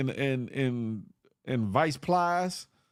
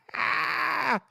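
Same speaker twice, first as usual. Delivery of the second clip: sudden high whooping laugh; real high laugh; high laugh burst; 12 semitones higher than usual. Real, not acted.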